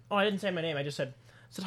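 normal talking voice

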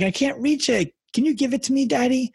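a kid on the verge of crying, lightly imitated; mock near tears. Dad retells his daughter close to tears over her toy, mock whimpering child. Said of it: "getting close to tears".

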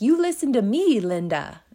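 put-on high, loud little kid scolding Linda; bossy kid yelling. A little kid scolding Linda, loud and high. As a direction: bark it like an outraged small child. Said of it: "This little kid is like".

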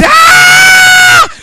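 long, high mock scream; loud drawn-out "aah" of shock; acted shriek of amazement; astonished scream. Acts servant seeing heavenly armies.

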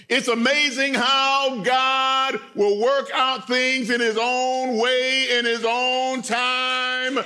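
preaching. Acted. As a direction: lift it into a sermon climax. Preacher rising into exhortation.

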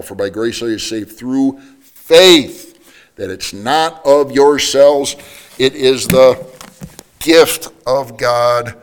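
slow, loud, drawn-out scripture; preaching at full force. Acted. Preacher pounding the verse to the congregation, slow and loud. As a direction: hammer each phrase of the verse home.

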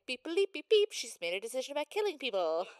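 high, loud imitated phone alert; alert notification. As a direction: chirp it out like an incoming alert. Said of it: "like, Google alert for her visions".

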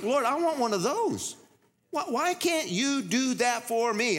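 put-on high, slow childish whining to God; envious whine. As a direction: beg like a jealous kid. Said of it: "It's like".

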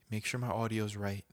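ordinary talk

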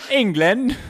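high-pitched mock English accent; hammed-up English accent. Shown as a demonstration. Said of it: "that's how I do all of 'em".

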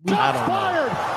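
sudden high, loud, slow mock outburst; mock shout. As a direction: blurt it out as a hammed-up yell. Dodging the R question.

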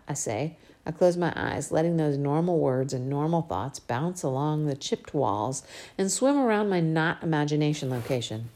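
usual speaking voice

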